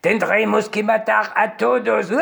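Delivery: mock German villain accent; villain line in a German accent; german accent spanish. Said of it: "hablaban con acento alemán".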